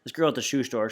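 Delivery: ordinary delivery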